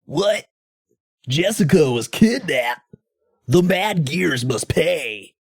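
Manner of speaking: high, slow, hammy action-hero vow; Cody reacting to the kidnapping. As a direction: deliver the vow like a cheesy brawler hero. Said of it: "and he says".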